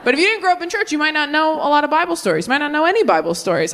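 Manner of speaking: usual speaking voice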